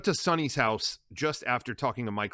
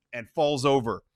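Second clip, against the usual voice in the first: ordinary talk, nothing marked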